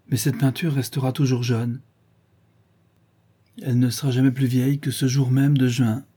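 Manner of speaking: ordinary delivery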